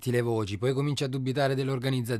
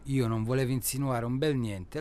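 usual speaking voice

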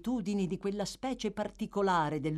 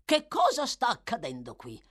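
loud, high-pitched demand; demanding questioner; 7 semitones higher, 5 dB louder than usual. A narrator's reading. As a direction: demand to know at once.

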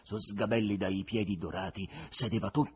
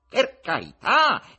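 much higher, louder, slow indignant cry; offended exclamation; 11 semitones higher, 10 dB louder, slower than usual. Acted. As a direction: sound wounded at the very idea. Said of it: "esclamò con voce offesa".

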